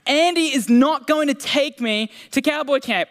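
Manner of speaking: a lightly hammed-up panic; freaking out Woody. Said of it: "he is freaking out 'cause he's like".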